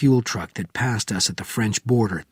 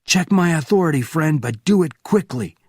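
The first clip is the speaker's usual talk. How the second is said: high and loud; firm command; 6 semitones higher, 4 dB louder than usual. Acted. Benjamin ordering the officer, high and loud. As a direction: demand it forcefully.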